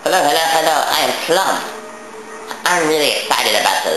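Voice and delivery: character voice. Acted. Voicing game character Plum.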